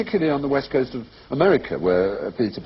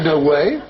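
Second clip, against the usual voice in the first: high and louder, WAY lifted; upspeak; 6 semitones higher, 5 dB louder than usual. Acted. Retelling what I was like, mock upspeak. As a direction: push "No way" up like a question. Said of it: "I was like".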